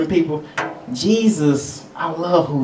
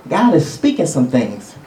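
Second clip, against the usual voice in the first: usual speaking voice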